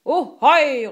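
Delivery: high, loud drawn-out hail; pirate shout. A narrator's reading. Pirate Killer answers the captain, shouting. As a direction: holler it across the deck. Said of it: "råbte Killer".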